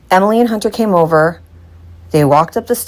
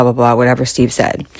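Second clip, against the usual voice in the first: ordinary delivery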